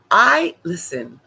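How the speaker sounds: ordinary talk, nothing marked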